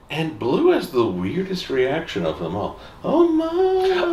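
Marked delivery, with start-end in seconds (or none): 3.0-4.1 mock shock. Real, not acted. Reacting to blue's weird reaction.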